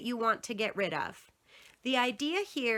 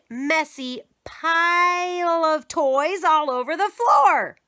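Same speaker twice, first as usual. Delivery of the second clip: usual speaking voice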